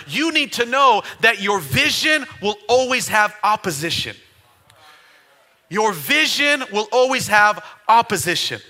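loud, driving preacher cadence, music under it; full-force preaching. Acted. Pastor urging the congregation to write this down, full-force preaching.

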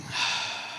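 soft, breathy sigh of doubt; heavy acted sigh. Believer stumped while praying to God, soft sigh. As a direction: let out a long, weary breath.

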